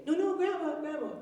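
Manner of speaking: put-on high, begging child voice; pleading little girl. Grandma imagines granddaughter begging her, high pleading child voice. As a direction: beg like a small granddaughter caught out.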